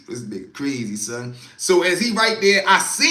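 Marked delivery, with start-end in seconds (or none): 0.1-1.3 low quiet laughing mutter; muttered disbelief. Retold or imitated. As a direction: mutter it, shaking your head.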